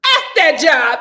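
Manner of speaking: loud laughing kiss-off. Real, not acted. Dismissing her old job to her chat, laughing loudly.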